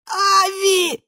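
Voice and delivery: very high panicked squeak; rubbery high squeaky toy. A narrator's reading. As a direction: squeal it in terror. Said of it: "пискнул инвалид".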